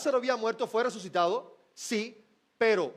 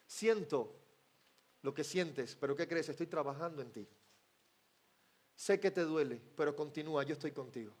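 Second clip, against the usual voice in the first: much softer and lower, hushed and tender; intimate voice of Jesus; 7 semitones lower, 8 dB quieter than usual. Acted. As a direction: speak close like a comforting whisper. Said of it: "Jesús le podría decir". (Jesús al que sufre, voz muy suave y tierna)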